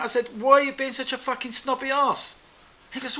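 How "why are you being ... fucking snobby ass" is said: higher-pitched retold telling-off; indignant confrontation. Rick telling Peter off for snubbing him, indignant. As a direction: challenge him over the snub.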